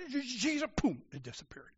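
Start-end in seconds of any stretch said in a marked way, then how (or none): 0.1-0.7 finally forcing the name out; breakthrough cry. Retold or imitated.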